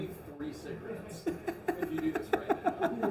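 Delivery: whispered through laughter; laughing. Retold or imitated. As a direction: giggle the offer out in a hush. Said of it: "Just be like".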